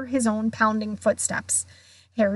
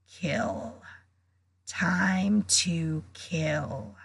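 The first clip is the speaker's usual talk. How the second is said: low, slow, murderous monster voice; cold murderous voice; 5 semitones lower, 5 dB quieter, much slower than usual. A narrator's reading. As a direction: drag it out, starving and deadly. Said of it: "same cold, murderous voice".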